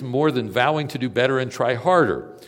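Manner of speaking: loud, high preaching; fervent pulpit delivery; preaching at full force. Acted. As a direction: proclaim it to the back row.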